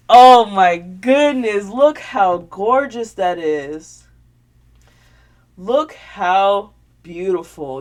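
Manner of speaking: loud, slow gush over the gift; drawn-out delighted oh my goodness; excited gasp of awe. Real, not acted. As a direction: marvel out loud at it. Gushing over handmade blanket.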